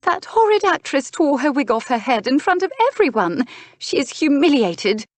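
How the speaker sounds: high, scandalised outrage; dramatic high-pitched indignation; distraught duchess. Acted. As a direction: exclaim it as an appalled duchess.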